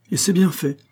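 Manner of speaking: mildly emphatic, decided verdict. A narrator's reading. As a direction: state it with conviction. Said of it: "d'un ton décidé".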